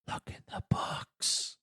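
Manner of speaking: slow, hushed whisper; whispering old man. Retold or imitated. Old man advising the boy inside the dragon, slow whisper. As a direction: whisper it like a distant old man's voice. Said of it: "the old man going".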